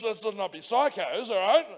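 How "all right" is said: normal talking voice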